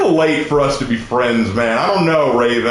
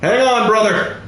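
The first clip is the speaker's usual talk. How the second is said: higher, urgent rally; urgent calling out; 6 semitones higher than usual. Real, not acted. Rushing to rescue Havoc in the game, urgent calling. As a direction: call out to a teammate in trouble.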